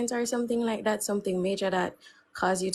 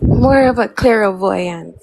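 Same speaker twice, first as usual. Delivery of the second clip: mock mystical; 12 dB louder, slower than usual. Mystic guest announces herself.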